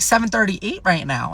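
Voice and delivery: normal talking voice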